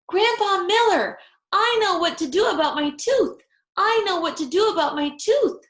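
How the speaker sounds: high, loud, joyful calling out; happy holler. A narrator's reading. Junie hollers her tooth plan to Grandpa, happy. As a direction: yell the news across the house. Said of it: "I hollered, real happy".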